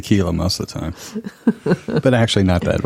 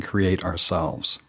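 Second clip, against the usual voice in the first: whisper; 4 semitones lower, 7 dB quieter, much slower than usual. Real, not acted. Whispered end of question.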